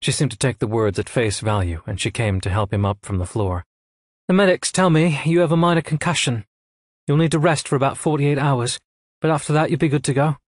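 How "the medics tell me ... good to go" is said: higher, crisp commanding woman; brisk officer delivering orders; a hint of a cool female commander; lightly voiced admiral. A narrator's reading.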